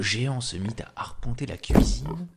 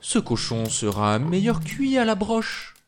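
giantess; 8 semitones higher than usual. Acted. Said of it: "dit la géante".

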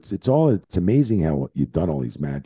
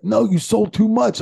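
much higher, louder mock complaint; Bubba complaining; 5 dB louder than usual. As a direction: scold him for overselling, loud and high. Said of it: "He was like".

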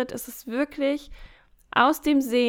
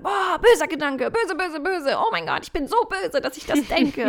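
hammed-up horrified scolding of her own bad thought, with a laugh; mock self-scolding; 4 semitones higher than usual. A podcast host mocks the inner critic who fights every thought, with a hammed-up scold. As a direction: play at scolding yourself, light and half-laughing. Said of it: "zu sagen".

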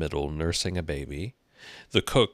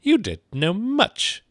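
slow, high, loud put-down; imperious high-pitched character voice; disagreeable Duchess; 7 dB louder, slower than usual. A narrator's reading. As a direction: squawk it scornfully, drawing it out. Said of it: "did not at all like the tone of this remark".

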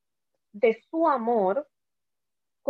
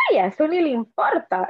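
higher and louder, breezy and dismissive; imitating her carefree mother; 4 semitones higher, 5 dB louder than usual. Mother brushing off callers' worried questions, breezy and unbothered. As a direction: toss it off like nothing's wrong. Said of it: "mi mamá decía".

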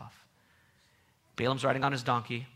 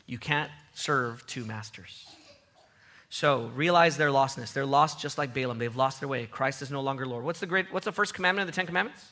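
ordinary talk

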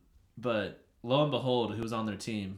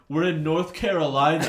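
loud, raised-pitch protest, imitating players; mimicked bench retort; 5 semitones higher, 10 dB louder than usual. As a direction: shout back as the indignant bench. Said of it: "the bench would start saying".

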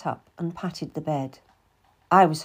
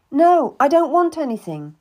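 louder, higher sharp no; hurt refusal; 8 semitones higher, 8 dB louder than usual. Acted. Cat turns down Anne's wine, hurt. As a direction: refuse her flatly, stung.

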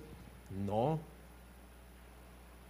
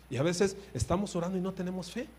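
usual speaking voice

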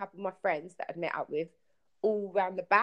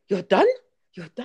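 put-on a light Egyptian accent, the first "done" louder and rising; 4 semitones higher, 8 dB louder than usual. Camel man echoes her in disbelief, lightly imitated Egyptian accent. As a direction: mimic an Egyptian man's incredulous echo in a mild Egyptian accent. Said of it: "in his Egyptian accent".

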